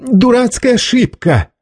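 high, exasperated protest; indignant and high; flaring up. A narrator's reading. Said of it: "вспылил обречённый".

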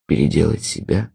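low, soft, hollow muttered question; low muttering echo with a questioning rise. A narrator's reading. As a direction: mumble it to yourself, stunned. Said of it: "бормотал он глухо".

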